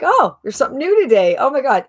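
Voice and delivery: high, loud, quick acted excitement; delighted surprise. Host voicing a member finding new content, excited. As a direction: burst out as if finding a nice surprise.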